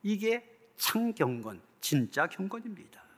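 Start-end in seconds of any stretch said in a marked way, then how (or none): none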